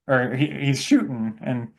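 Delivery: ordinary talk, nothing marked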